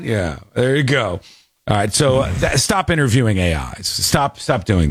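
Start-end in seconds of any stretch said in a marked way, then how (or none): none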